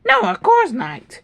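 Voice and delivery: high, slow whiny voice; high nervous denial. Acted. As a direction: squeak out a lying denial. Subordinate denying to leader.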